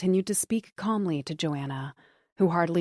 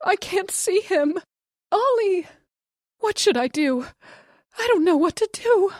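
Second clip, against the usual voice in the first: panicked sobbing; 13 semitones higher, 6 dB louder than usual. A narrator's reading. Sobbing wife panics on phone.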